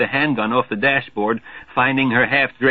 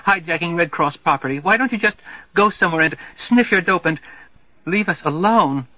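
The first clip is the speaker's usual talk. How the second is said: high, indignant tirade; shrill scolding; 8 semitones higher than usual. Acted. Girl berating the hijackers, high indignant tirade. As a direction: tear into the hoodlum. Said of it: "she screams".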